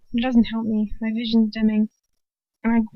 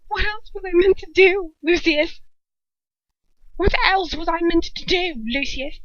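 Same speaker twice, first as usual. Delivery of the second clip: high, loud pleading; pleading woman; 7 semitones higher, 4 dB louder than usual. A narrator's reading. Bellatrix pleading with Lucius over her act. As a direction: beg him to understand.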